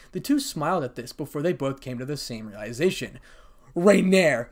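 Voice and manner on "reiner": loud, raised exclamation; cried out. A narrator's reading. Both girls suddenly realizing who it was, cried out. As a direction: blurt the name out in realization. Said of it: "They both cried".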